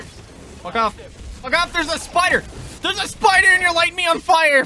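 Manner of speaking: panicked high screaming; screaming at a spider. Real, not acted. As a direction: shriek it in panic, rising to a full scream.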